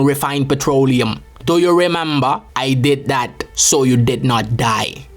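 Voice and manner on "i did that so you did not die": normal talking voice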